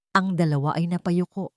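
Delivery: ordinary delivery, nothing marked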